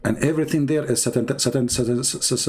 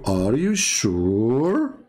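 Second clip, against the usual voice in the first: slow drawn-out question; 2 semitones lower, much slower than usual. Shown as a demonstration. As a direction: stretch "Are you sure?" very slowly, rising. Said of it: "When you hear ... saying to you".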